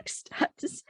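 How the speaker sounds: breathy whispered plea; whispered stop this. Real, not acted. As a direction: hush it out, embarrassed. Embarrassed plea amid laughter.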